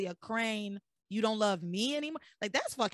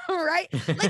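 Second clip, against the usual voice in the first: laughing it out, higher and louder; 6 semitones higher, 5 dB louder than usual. Real, not acted. Host cracking up at Ethan's line to Teresa, laughing. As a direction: break up laughing at how absurd he is.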